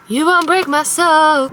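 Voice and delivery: a light impression of a friend's catchphrase. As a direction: drag out "You won't break my soul" like a meme. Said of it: "That's [name] every time he sees me".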